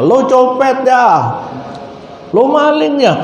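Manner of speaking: high accusing bark at the witness; mock accusation. (tuduhan pura-pura marah)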